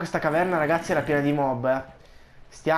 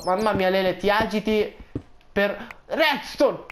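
imitazione di uno spettatore; 4 semitones higher, slower than usual. Retold or imitated. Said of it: "uno di voi mi ha detto".

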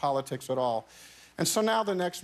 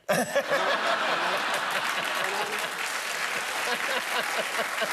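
long, high rolling laugh; 5 semitones higher than usual. Real, not acted. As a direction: let a long laugh roll out. Laughing at the pool twist.

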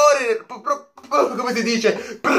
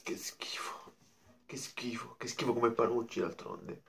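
very low, whispered mock-disgusted voice; 13 semitones lower, 20 dB quieter than usual. Fan dismissing Panucci as Roma coach, deep disgusted whisper.

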